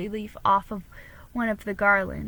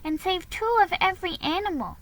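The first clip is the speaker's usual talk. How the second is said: put-on high, curious child asking a question; a young girl asking about Noah; little girl voice; 10 semitones higher, 4 dB louder than usual. A narrator's reading. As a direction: pitch it up like a small girl puzzling. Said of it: "said Nan".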